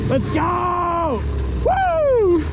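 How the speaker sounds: real elated shouting, climbing very high; high-pitched victory yell; triumphant celebration. Real, not acted. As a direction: whoop and holler over a huge catch. Celebrating the catch.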